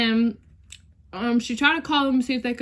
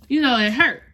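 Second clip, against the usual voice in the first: normal talking voice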